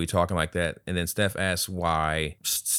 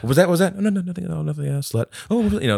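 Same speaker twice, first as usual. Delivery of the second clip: catty fake innocence; 10 semitones higher, 5 dB louder than usual. Catty spat between characters.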